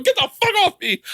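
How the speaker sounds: high frantic yelp; hammed-up high-pitched alarm; mock panic. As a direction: imitate someone flailing at a swarm. Dancers really fighting off bees.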